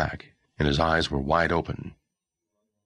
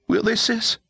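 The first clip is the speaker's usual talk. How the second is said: put-on high, panicky boy's voice; a scared boy blurting it out; panicked boy; 4 dB louder than usual.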